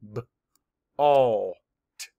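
B, all, t each said as a separate sound; segmented sounds of bolt; sounds mapped apart. Shown as a demonstration. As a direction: tap out B, all, t one sound at a time. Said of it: "Map the sounds".

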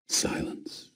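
toneless breathy whisper. A narrator's reading. Narrator marking the silence after the fall, whispered.

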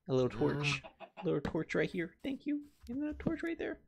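put-on very high, soft baby-talk voice; high cooing. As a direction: coo over the torch like a pet. Cooing over placing torches.

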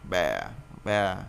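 "bè" voiced low in the throat; held in the throat. Shown as a demonstration. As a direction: drop "bè" deep into the throat, drawn out. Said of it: "trong họng".

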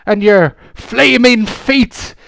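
high, very loud pirate shrieks, drawn out; panicked, full-voiced wailing and cursing; horrified scream. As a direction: scream in terror at the orc. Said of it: "she screams in horror".

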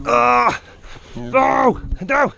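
high, alarmed no, no. Real, not acted. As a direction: stop them in alarm. Stopping animal.

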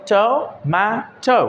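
টমেটো broken up slowly as "to, may, to"; three syllables. Shown as a demonstration. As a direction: pause between each syllable of টমেটো. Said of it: "তিনটি syllable রয়েছে". (টমেটো ধীরে তিন সিলেবলে ভেঙে)

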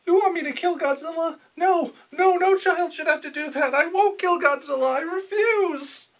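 put-on high, whiny young boy voice. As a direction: play a timid little anime boy. Boy refusing to kill Godzilla.

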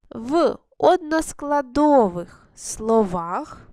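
short chunks with long pauses between them; feed the rule slowly, phrase by phrase, for pupils to repeat; measured teacher's dictation, leaving gaps for echoing; dictated for repetition. Shown as a demonstration. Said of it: "Я декілька слів промовляю, ви повторюєте".

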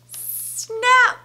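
high, loud snap after a drawn-out hiss; sudden snap effect. A narrator's reading. Storyteller sounds the breaking tusk, sudden loud snap. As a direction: stretch the start, then burst out high.

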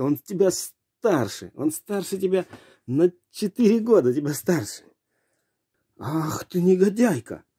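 put-on high-pitched nagging old-woman scolding; shrill nagging grandma. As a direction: scold the dog like a fussy grandma.

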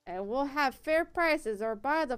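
put-on loud rallying call in a country accent. Mob leader calling off his lads, loud country accent. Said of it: "gave that guy a country accent".

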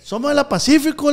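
loud, high yell of warning; loud mock shout across the beach; retold shout. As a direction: call out to stop them. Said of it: "me grita".

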